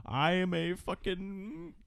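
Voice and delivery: put-on slow, high creepy old man voice; slow ominous raspy drawl. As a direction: drag it out like a crazed prophet. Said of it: "And he's like".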